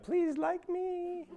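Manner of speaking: high, slow, pitiful plea; a shy new worker offering cake, pleading in a high voice; pleading office worker. Retold or imitated. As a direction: beg for approval in a high, slow voice. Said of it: "and be like".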